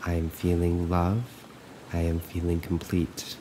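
calm, even affirmations with long silences between; guided meditation. Acted. Coach looping affirmations for listeners to follow, calm and measured. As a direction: recite evenly, leaving room to repeat.

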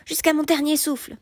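high-pitched, defiant cry; vehement vow. A narrator's reading. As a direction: swear undying enmity. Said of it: "s'écria Gauvin". (serment crié, plein de défi)

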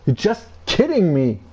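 ordinary talk

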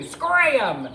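loud, high, falling yell; shouted warning. Acted. Ankylosaurus warns Parasaurolophus, loud high shout. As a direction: shout it to send them fleeing. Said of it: "she shouted".

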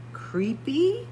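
ordinary delivery

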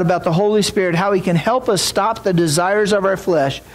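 ordinary delivery

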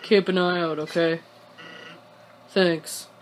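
low, laid-back character voice; game character voice. Acted. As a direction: read it low and level, in character.

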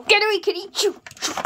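high, loud shoo; shouting away. Pretend. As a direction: shout the kitty off. Toy shoos the kitty.

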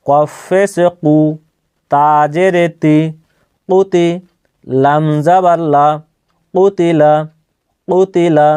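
name each letter and vowel sign, then blend them; spelling out syllables. Shown as a demonstration. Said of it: "একবার বানান করে আপনাদেরকে দেখাচ্ছি". (ধীরে ধীরে বানান করে পড়া)

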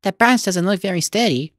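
put-on high child's voice, uneasy; worried boy. A narrator's reading. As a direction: caution a friend as a schoolboy. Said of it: "said one".

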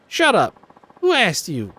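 hammed-up angry snap; snapping rival. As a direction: shut her down fast. Ulala snaps at Haruka.

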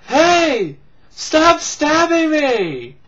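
high, loud drawn-out protest; mock yell. Acting out the stabbed guy, hammed-up loud yell.

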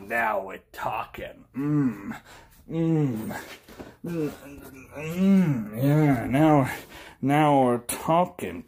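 low, breathy-sounding mock rapture; mock sultry moaning. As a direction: purr yeah in hammed-up pleasure.

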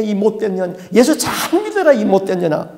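loud, high spitting insult; the demon cursing the daughter; bitter curse. Retold or imitated. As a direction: curse her through the tears.

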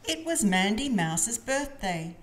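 ordinary delivery

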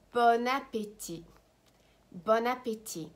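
"bon appétit" with no T sound at the end; silent final T. Shown as a demonstration. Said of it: "do not pronounce the T".

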